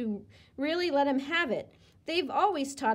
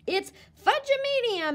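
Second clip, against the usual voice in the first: high loud gleeful shriek; 12 semitones higher, 5 dB louder than usual. A narrator's reading. Little girl shrieking while twirling, gleeful shriek. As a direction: squeal it out wildly.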